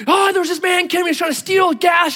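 put-on high, excited child's voice; boy bursting with news; hyped-up kid. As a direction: retell it like a wound-up five-year-old. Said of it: "he's just all hyped up".

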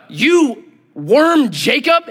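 high, slow, drawn-out mock call; hammed-up, elongated address; God addressing Jacob. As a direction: stretch out the name-calling teasingly. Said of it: "He goes".

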